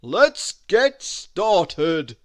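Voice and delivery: loud, slow booming Santa voice; rally the helper heartily; Santa eager. A narrator's reading. Santa setting off with Mister Snow: loud eager Santa voice. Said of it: "beamed Santa Claus".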